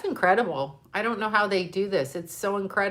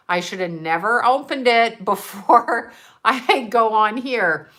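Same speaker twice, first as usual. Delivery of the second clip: words breaking into laughter, louder at the start; laughing regret; 3 semitones higher, 5 dB louder than usual. Retold or imitated. Streamer telling viewers about sobbing over a gift, laughing regretfully. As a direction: laugh through the self-reproach.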